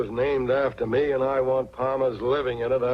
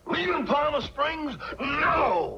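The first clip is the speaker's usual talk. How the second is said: shocked; 12 semitones higher, much slower than usual. Acted. Grandpa shocked by relatives leaving.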